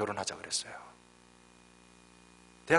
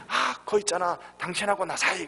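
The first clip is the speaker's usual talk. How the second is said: grandpa hinting; 8 semitones higher than usual. Retold or imitated. Grandpa hints on TV game.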